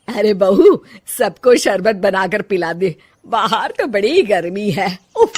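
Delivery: put-on loud, commanding older woman calling her daughter-in-law; bossy mother-in-law. Mother-in-law orders her bahu, loud and bossy. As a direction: boss the bahu around from the doorway.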